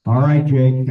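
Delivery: loud, called out to a listener; to someone hard of hearing. Real, not acted. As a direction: project it so Jake can hear. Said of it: "Jake said he can't hear you".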